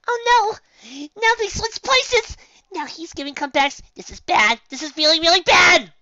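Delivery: very high, loud frantic wail; high loud frantic panic; panicking. A narrator's reading. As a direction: squeal in alarm at the turnaround. Pinkie panics.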